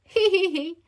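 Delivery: the group laughing at George's roar; giggling. Acted. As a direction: giggle like a delighted child. Everyone laughing.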